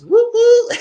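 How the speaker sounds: very high loud "woo woo", then cackling; celebratory whoop and laugh. Real, not acted. Host celebrating birthday season, high whoop into loud laughter.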